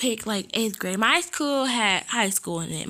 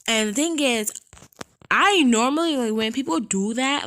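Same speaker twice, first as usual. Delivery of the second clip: worked up; 5 semitones higher, 6 dB louder than usual. Real, not acted. Getting worked up.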